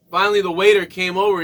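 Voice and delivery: ordinary talk, nothing marked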